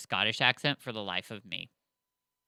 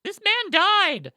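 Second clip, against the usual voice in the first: much higher, slow, loud disbelief; shocked outburst; 8 dB louder, slower than usual. Retold or imitated. Texting her friend after a shocking episode, stunned. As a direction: gasp it out like the twist just hit you. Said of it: "I was like".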